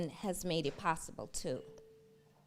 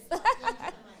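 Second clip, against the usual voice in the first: high, breathy nervous giggle; nervous laugh. Real, not acted. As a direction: laugh off the jitters. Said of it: "I'm a little nervous".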